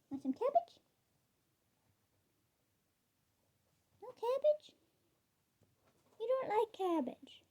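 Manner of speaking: coax, then sound let down for a pet; cooing pet talk. Real, not acted. Coaxing the pet rabbit with food.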